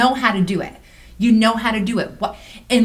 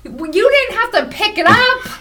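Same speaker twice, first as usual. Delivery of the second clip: indignant; 13 semitones higher, 4 dB louder than usual. Real, not acted. Indignant retort.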